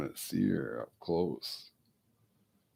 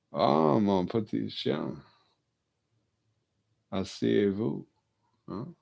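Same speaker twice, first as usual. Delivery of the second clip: louder, higher sweet French "mon petit chien"; French to dog; 4 semitones higher, 5 dB louder than usual. Shown as a demonstration. Owner sweet-talking dog in French, louder. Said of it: "I'll switch to French".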